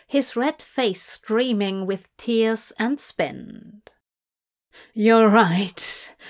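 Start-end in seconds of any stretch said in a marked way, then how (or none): 4.9-6.2 lightly wheezed, out of breath; breathless wheeze. A narrator's reading. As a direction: push it out on what breath is left. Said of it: "Crowley wheezed".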